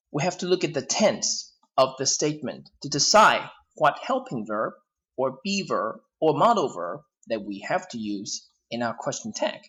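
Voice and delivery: ordinary delivery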